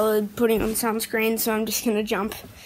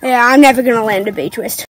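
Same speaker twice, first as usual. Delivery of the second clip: loud; 2 semitones lower, 14 dB louder, faster than usual. Real, not acted. Gives up, loud.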